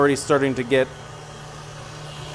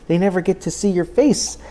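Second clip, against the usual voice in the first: high, playful pet-talk pitch; 10 semitones higher than usual. Real, not acted. Owner scolds dog for hiding her face, high pet-talk. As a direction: pitch it way up, chiding the dog fondly.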